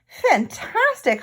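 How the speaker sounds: high, slow drawn-out fantastic; bunny amazed at the painting; exclaiming. Acted. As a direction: gasp it out in wonder. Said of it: "Hoppy exclaimed".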